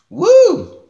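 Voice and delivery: sudden high-pitched woo at the sourness; high sour whoop. Real, not acted. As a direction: yelp high and loud as the sour hits. Reacting to sour candy.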